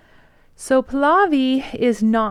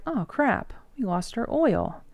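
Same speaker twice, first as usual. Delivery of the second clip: mock dismay; 2 semitones higher, 4 dB quieter than usual. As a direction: imitate a small, dismayed realization. British realize they lost the oil.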